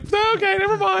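very high falsetto squeal; comic high-pitched surrender; squealed backing down. Retold or imitated. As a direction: back off fast in a tiny, sky-high voice. Acted panicked backing down on phone.

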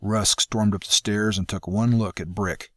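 ordinary delivery, nothing marked

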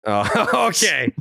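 normal talking voice

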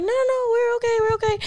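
high-pitched, protesting no-thanks; hurried polite refusal. Retold or imitated. Granddaughter turning down Papa's money, high polite protest. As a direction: wave off the offer in a high voice.

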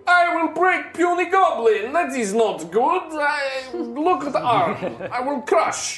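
high, loud brutish broken speech; simple-minded warrior boasting; barbarian. Acted. Minsk speaks in broken speech.

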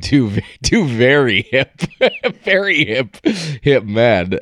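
dripping with sarcasm, laughing; mock praise, laughing through it; sarcastic. Real, not acted. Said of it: "dripping with sarcasm".

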